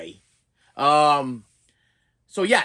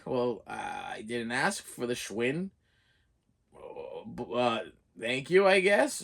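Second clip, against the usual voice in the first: much lower and softer, slow; sheepish mumble; 9 semitones lower, 7 dB quieter, slower than usual. Retold or imitated. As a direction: trail off awkwardly. Awkward reluctant thanks.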